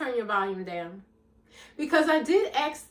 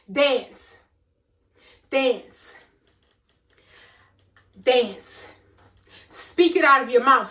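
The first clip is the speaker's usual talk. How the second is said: impassioned preaching; 3 semitones higher, 5 dB louder, slower than usual. Acted. Speaker urging her audience to celebrate pain, fervent preaching. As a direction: preach it with full force.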